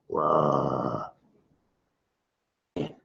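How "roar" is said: soft growl. Shown as a demonstration. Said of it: "Growl like a polar bear right now".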